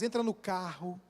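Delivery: normal talking voice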